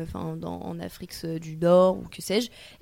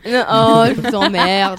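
mock outrage; 10 dB louder, slower than usual. Real, not acted.

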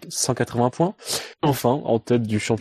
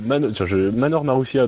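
normal talking voice

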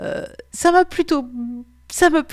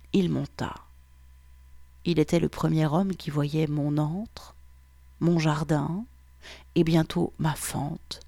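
erotic story narration; 3 semitones lower than usual. A narrator's reading. Podcast host reading a listener's erotic story, intimate narration. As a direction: draw listeners in, sensual and unhurried.

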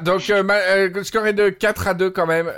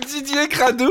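laughing; 8 semitones higher than usual. Real, not acted. Laughing at the pun.

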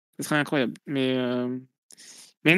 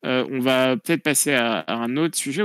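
ordinary talk, nothing marked

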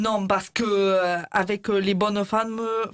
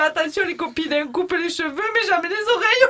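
laughing at her joke; 10 semitones higher than usual. Acted. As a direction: crack up at your own joke. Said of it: "c'est une blague de coiffeuse".

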